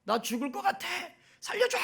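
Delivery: put-on high, shrill-pitched cries of a drowning girl; acted panic yell from the water; panicked scream for help. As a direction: scream for rescue, pitch flying up. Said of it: "막 소리를 지릅니다".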